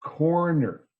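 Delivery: careful, R-full corner; both R's in corner. Shown as a demonstration. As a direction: drill corner with each R pronounced. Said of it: "Over and over and over again".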